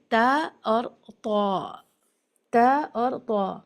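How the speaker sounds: تا light and طا heavy, said slowly; ta versus heavy ta. Shown as a demonstration. Teacher slowly contrasting the two letters. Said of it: "دو possibilities ہیں".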